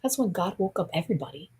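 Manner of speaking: usual speaking voice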